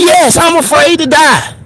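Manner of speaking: lightly voiced director admitting fear. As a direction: toss off a high-pitched yes. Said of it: "He told me".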